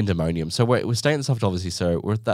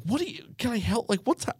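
mock panicked dad, pitched up high; flustered dad; 13 semitones higher, 6 dB quieter than usual. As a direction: squeak it out like a dad losing it in the crowd. Dad overwhelmed in busy shop.

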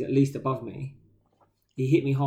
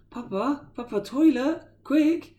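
put-on high, urgent little-girl voice; squeaky toddler calling for the toilet; imitating toddler; 13 semitones higher, 4 dB louder, slower than usual. Daughter calling her dad to the toilet, high and urgent. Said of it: "she'll just be like".

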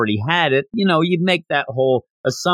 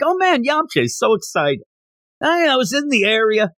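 nervous mimicry; 9 semitones higher than usual. Goku mimics nervous Yamcha.

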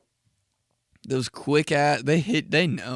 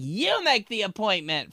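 higher bossy mom; 8 semitones higher than usual. Retold or imitated. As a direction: order it like a mom. Mom voiced in story.

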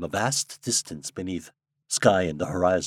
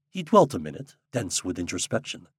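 usual speaking voice

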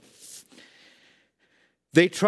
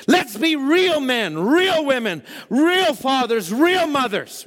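impassioned preaching; 11 semitones higher than usual. Real, not acted. Preacher urging the congregation to be real families, impassioned preaching. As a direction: drive each phrase home with stretched stressed words. Exhorts the congregation at full force.

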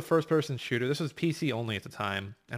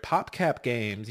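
ordinary delivery

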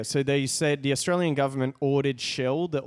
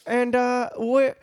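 put-on high, silly mishmash of European accents; 10 semitones higher, 4 dB louder than usual. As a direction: play an envoy in a badly faked European accent. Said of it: "I'm from all of Europe".

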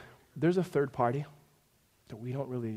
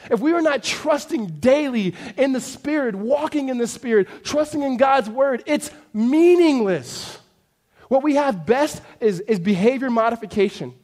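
loud, high, insistent sermon build; impassioned preaching; 8 semitones higher, 10 dB louder than usual. Acted. Preacher warning congregation they need the Spirit, forceful and urgent. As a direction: drive it home hard, stretching the key word.

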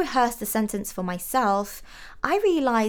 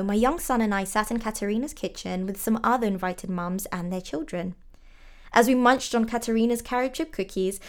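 ordinary delivery, nothing marked

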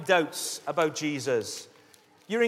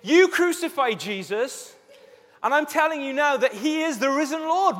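bold proclamation; 6 semitones higher, 3 dB louder than usual. Acted. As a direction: proclaim it to a huge crowd. Peter preaching to huge crowd.

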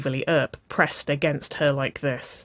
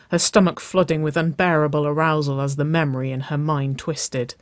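plain reading voice

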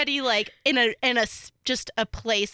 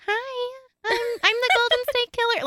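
imitating a creepy high falsetto killer; squeaky high-pitched mock whisper, eerie; 13 semitones higher than usual. Podcaster imitates the killer greeting his victims, high falsetto. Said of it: "whispered in a high-pitched falsetto voice".

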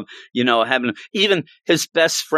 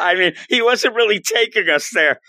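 annoyed grandson; 9 semitones higher than usual. Retold or imitated. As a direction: complain like a fed-up kid. Normie complaining about grandpa.

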